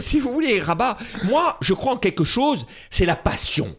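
hammed-up Corsican dad voice; imitating his Corsican father. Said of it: "je réponds avec mon père".

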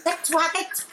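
high-pitched acted cry to stop; mock yell at a strained high pitch; retold yell. Passenger on the Vespa crying out to the driver, mock yell. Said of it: "Je crie, hurle".

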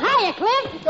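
booming laughing hello to a fellow mountain; mountain greeting. Acted. Mountain calls out to Clifton with a laughing shout. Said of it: "as one mountain said to the other".

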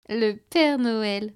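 slow, drawn-out amused echo; amused repeat. A narrator's reading. Hostess repeats the child's words, slow and amused. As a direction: stretch it out, half laughing at the child. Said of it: "répéta la jeune femme en riant".

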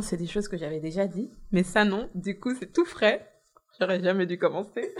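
soft, tearful, sniffly; snotty crying. Real, not acted. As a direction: hold back tears quietly. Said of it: "est-ce que tu veux prendre un mouchoir?".

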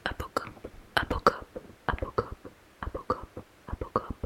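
very soft, whispered ASMR repetition. Shown as a demonstration. French teacher recording a favourite word for ASMR, whispered. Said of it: "faire l'objet d'un enregistrement A S M R".

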